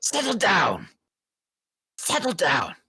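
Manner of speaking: high, sharp guard's shout; Yuan-ti guard. Acted. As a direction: snap at the prisoner like a snake-man guard. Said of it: "jabs it into the cage".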